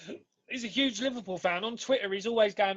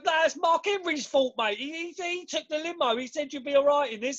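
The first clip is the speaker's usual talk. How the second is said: higher, cheeky cab-driver geezer voice; London geezer; 6 semitones higher than usual. Retold or imitated. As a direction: play the geezer shrugging it off. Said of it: "he goes".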